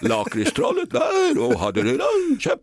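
a lightly put-on celebrity voice; guess-who impression, half-hearted. Host opens the impression day himself, lightly put-on voice. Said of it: "Jag kan starta upp med en här".

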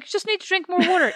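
high, insistent mock voice; imitating bossy leader. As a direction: lecture her in a high put-on voice. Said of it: "She's like".

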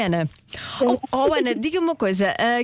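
normal talking voice

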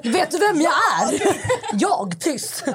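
high, laughing diva indignation; mock outrage. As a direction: act scandalised, pitch jumping up through laughter. Mock outrage at being told silence.

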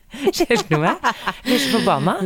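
laughing, half outraged. Real, not acted. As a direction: laugh while you tell it. Laughing while telling anger.

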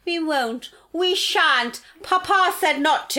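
high, childish mock pleading; mock Tory whining. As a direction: whine like a spoiled child. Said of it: "they're like".

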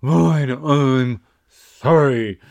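drawn-out, exasperated fake sorry; hammed-up huffy apology, dragged out; grudging mock apology. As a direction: imitate a put-upon, reluctant apology, very slow. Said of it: "and you go".

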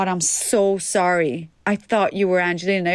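lightly voiced embarrassed stranger, slow on "sorry"; a hint of the mortified American fan apologising; imitating apologetic stranger. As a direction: play the stranger sheepishly apologising. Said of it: "Han bara".

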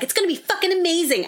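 high overexcited mock hype; hyped promoter. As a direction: oversell it like a boastful promoter. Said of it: "I don't wanna be like".

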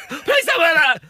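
high, loud desperate plea; pleading dad shout. Pretend. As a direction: beg your daughter not to wear it. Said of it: "apart from go".

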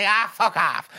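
high, mock brush-off; dismissive granny. Granny brushing off her scolding daughter, dismissive. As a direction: wave it away as the cranky granny.

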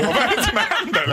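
high, laughing disbelief; half laughing, incredulous; mock disbelief. Teller recalling baffled thoughts about the operator, with a laugh. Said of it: "jag tänker".

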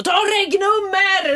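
high, loud, drawn-out shout; retold yell. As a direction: re-enact yelling an urgent order. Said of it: "skriker jag liksom".

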